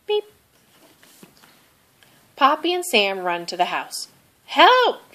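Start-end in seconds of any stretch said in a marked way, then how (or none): 4.5-5.1 high shout for help. A narrator's reading. Poppy shouting for help at the burning barn. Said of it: "shouts Poppy".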